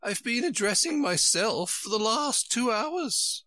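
high, plaintive storybook queen; high-pitched, put-upon Queen; complaining Queen. A narrator's reading. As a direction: moan on in a high royal voice.

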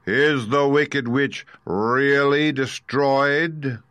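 high, loud, very drawn-out question; grand voice stretched with a touch of unease; slightly trembling Oz. Acted. Unseen wizard questioning Dorothy about the witch, slightly trembling. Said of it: "Dorothy thought it trembled a little".

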